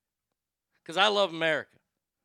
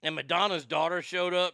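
ordinary delivery

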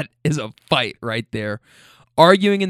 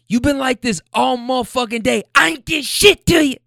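high imitated scream, building louder; top of lungs; 11 semitones higher, 5 dB louder than usual. Woman yelling at her man outside, top-of-lungs imitation. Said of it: "at the top of her lungs".

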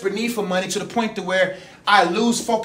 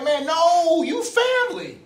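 excited exclamation; 8 semitones higher, slower than usual. Retold or imitated. His own retold hospital exclamation.

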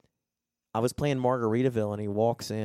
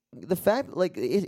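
ordinary delivery

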